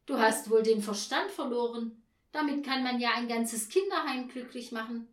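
loud exasperated scolding; exasperated father. Acted. Father rebukes daughter over her list, loud and exasperated.

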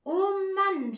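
high, loud drawn-out groan; groaning boys. A narrator's reading. Brothers in bed await tomorrow's note, loud groan. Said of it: "stöhnten Peter und Paul".

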